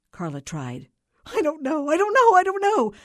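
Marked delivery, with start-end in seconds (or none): 1.3-2.9 high frantic cry, swelling louder; distraught caller losing control; hysterical wailing. A narrator's reading. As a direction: wail it helplessly over and over. Hysterical caller repeats her answer.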